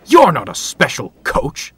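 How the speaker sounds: loud, indignant outburst; growled. A narrator's reading. Dudley finding Toad instead of coaches, angrily. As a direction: snap it out in annoyed disbelief. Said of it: "Dudley growled".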